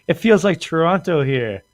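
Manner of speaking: high mocking tourist voice. Tourist joking the sea feels like Toronto. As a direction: act out the tourist, amused.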